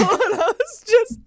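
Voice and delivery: words squeezed out through high laughter; cracking up while saying it; laughing hard. Real, not acted. Laughing at own retold story.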